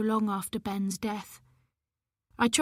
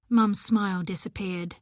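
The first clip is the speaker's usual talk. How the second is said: ordinary delivery, nothing marked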